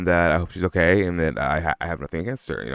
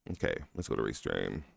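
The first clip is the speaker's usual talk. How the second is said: ordinary talk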